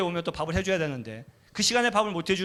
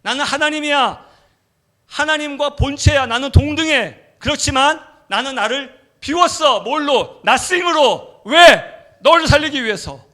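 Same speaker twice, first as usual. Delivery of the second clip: much higher and louder, building; preaching at full force; 7 semitones higher, 11 dB louder than usual. Acted. God telling the preacher why, thundering. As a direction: declare it with full power. God's climactic declaration preached.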